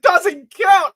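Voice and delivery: loud, high mock disbelief; incredulous outburst. Boy throws girl's excuse back, shouted mock disbelief. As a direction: shout it back in imitated outrage. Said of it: "I love that delivery".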